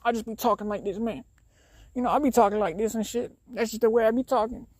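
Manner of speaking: hammed-up, high-voiced slangy guy impersonation. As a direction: put on a high voice imitating some guy's slang. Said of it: "guy being like".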